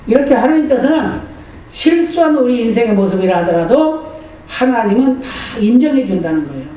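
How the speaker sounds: normal talking voice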